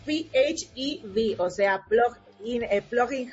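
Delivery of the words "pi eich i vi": P H E V spelled out slowly, "pee-aitch-ee-vee"; English letter names. Shown as a demonstration. Presenter explaining for those without English, P H E V slowly as "pi-eich-i-vi". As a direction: give each English letter of P H E V separately, slowly. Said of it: "en inglés".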